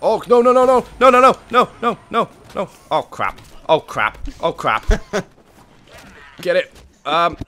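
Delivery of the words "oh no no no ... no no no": loud, high repeated alarm; panicked no-no-no. Real, not acted. Gamer about to crash, panicked. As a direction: rattle off the noes in alarm.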